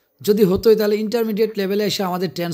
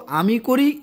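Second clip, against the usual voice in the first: ordinary talk, nothing marked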